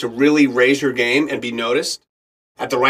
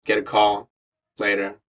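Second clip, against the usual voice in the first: usual speaking voice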